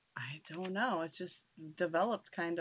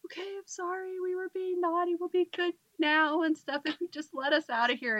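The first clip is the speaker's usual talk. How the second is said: scared pleading; 10 semitones higher, 4 dB louder than usual. Retold or imitated. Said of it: "She's like".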